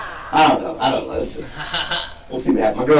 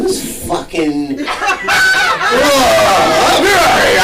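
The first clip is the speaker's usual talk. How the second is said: growling at dogs; 10 semitones higher, much slower than usual. Retold or imitated. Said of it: "growl into my window".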